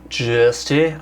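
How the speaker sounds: a slip into a light Australian twang; just here said lightly Australian; a hint of an Australian accent; accidental Australian. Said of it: "I don't know why I went Australian".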